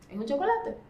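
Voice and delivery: high rising tune on the last word; questioning repeat. Acted. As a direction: lift it like a question, climbing on "chocolate".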